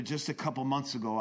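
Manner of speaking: ordinary delivery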